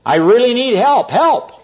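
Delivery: high-pitched acted call upward; mock yell for help. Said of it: "he finally yells out".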